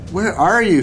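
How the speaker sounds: high, lightly projected searching call. A narrator's reading. Girl searching her closet for a doll, calling out. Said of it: "she called".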